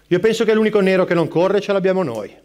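ordinary delivery

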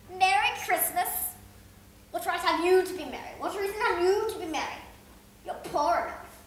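mock the nephew's cheer; scornful Scrooge.

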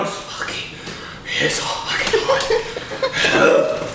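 breathless, pained and strained. Real, not acted. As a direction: squeeze it out through the burn. Suffering from the chili heat.

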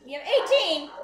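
shocked, loud outcry at the number; outraged surprise. Real, not acted. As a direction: exclaim the count in disbelief. Outraged at wood count.

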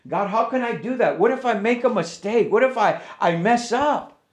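a hint of a nervous new believer begging God; retold worry. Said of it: "I would say that all the time".

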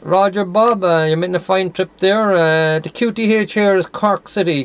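usual speaking voice

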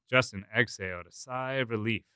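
ordinary delivery

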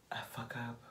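breathy whisper of confession; whispered admission. Real, not acted. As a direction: drop to a whisper, ashamed. Self-critical confession, whispered.

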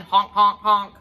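loud honking goose calls; goose honks. Acted. Reading to a child, loud goose honks. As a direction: honk it out like a noisy goose. Geese honking in picture book.

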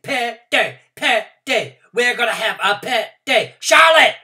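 very high, very loud, breathless yelling; excited hollering. A narrator's reading. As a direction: holler it to every kid in the room. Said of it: "I hollered".

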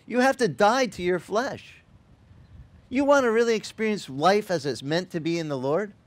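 ordinary delivery

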